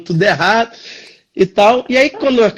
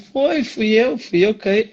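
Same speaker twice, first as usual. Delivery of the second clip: softer, mildly dejected admission; downcast, sheepish; 5 dB quieter, slower than usual. Retold or imitated. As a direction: own up meekly, reenacting embarrassment. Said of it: "eu assim, bem cabisbaixo". (confissão cabisbaixa, em voz baixa)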